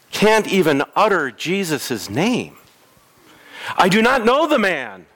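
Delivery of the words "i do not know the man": raised, higher pleading denial; Peter's panicked denial. Retold or imitated. Preacher voicing Peter denying Jesus, raised and pressing. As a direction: Peter insisting he doesn't know Jesus.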